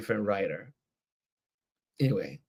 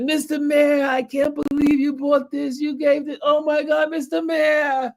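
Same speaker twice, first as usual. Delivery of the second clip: very high, sustained hammed-up squeal; mock-astonished gushing, pitched way up; retold shock; 3 dB louder than usual. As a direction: imitate an overjoyed man in a high falsetto. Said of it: "He was so shocked".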